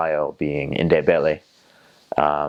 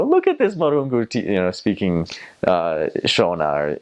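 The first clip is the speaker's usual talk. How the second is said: delighted locals; 3 semitones higher than usual. Retold or imitated. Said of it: "And they go".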